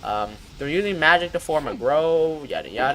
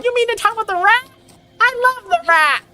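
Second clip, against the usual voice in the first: very high squeaky gush; high-pitched squeaky voice; 4 dB louder than usual. Acted. Streamer redoing the squeaky rat voice for chat. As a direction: squeak out your love like a cartoon. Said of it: "high-pitched squeaky voice".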